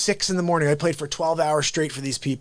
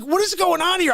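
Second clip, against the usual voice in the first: high-pitched, nervous disbelief; retold nervousness; 11 semitones higher than usual. Musician unsettled by his buddy's gun, retold nervousness. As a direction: act out rising alarm, voice pitched way up. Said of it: "I got nervous".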